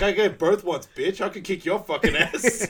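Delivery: high, loud threat; mock angry yell. Tammy threatening the man, angry yell.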